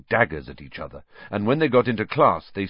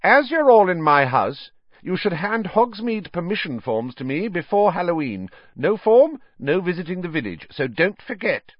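high, raised, firm announcement; strict teacher; 10 semitones higher, 5 dB louder than usual. A narrator's reading. McGonagall reminding her class about forms, high and firm. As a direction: lay down the rule to a whole class.

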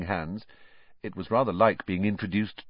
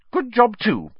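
high, louder motherly interjection; brisk; 7 dB louder than usual. A narrator's reading. Mother moving the talk on to luggage, brisk. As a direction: cut in and take charge. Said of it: "said Missus Weasley briskly".

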